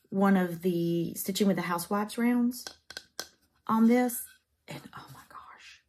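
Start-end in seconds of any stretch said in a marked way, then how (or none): 4.7-5.8 breathless hushed oh my gosh; awed whisper. Real, not acted. Crafter marvelling at a mounted stitching, whispered awe. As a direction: whisper it in delight. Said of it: "excited aside".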